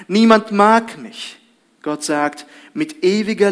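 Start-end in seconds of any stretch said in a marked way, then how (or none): 0.1-1.4 loud lonely lament. Pretend. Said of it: "Wir sagen".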